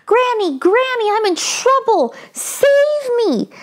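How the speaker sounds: high pleading calls to granny; a boy's high frightened call; scared cry for help. Acted. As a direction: beg granny to save him. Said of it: "Chiku cried".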